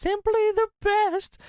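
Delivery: very high, slow sing-song catchphrase. Retold or imitated. Host mocking the wrestler's catchphrase, very high and slow. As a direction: croon it like a cheesy tagline. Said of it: "Cyrus wants his gimmick to be simply the best".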